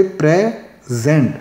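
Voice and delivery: Present split as PRE... ZENT; present with a pause in the middle; syllable by syllable. Shown as a demonstration. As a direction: slowly separate the two syllables of present. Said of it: "लफ्ज़ present में दो syllable थे".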